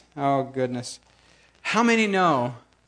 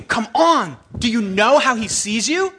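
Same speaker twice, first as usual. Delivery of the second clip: louder, higher, building preaching; rising exhortation; 5 semitones higher, 6 dB louder than usual. Real, not acted. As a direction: build it up to a shout for the church. Preaching climax.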